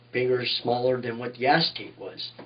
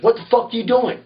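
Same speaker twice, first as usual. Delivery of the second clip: higher, louder angry demand; angry boss; 8 semitones higher, 4 dB louder than usual. Retold or imitated. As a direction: bark it at the kid caught out. Boss George confronting the narrator.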